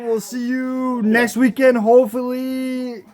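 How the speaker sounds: slow, stretched-out words; lingering, elongated podcast outro; drawn-out sign-off. Real, not acted. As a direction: drag out each phrase of the farewell.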